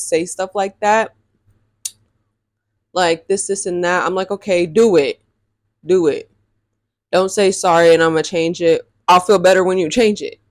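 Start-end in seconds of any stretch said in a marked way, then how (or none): 4.3-10.4 emphatic demand, louder on key words; mock-scolding, push each command home; firm challenge. Storyteller replays challenging him on his apology, firm and emphatic.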